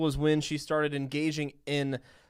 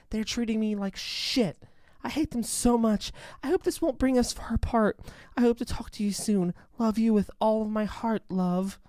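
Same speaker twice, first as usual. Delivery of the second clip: put-on a touch higher, reading a teen girl's email; reading a letter; 7 semitones higher than usual. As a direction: lightly voice the letter. Reads Jasmine's email aloud.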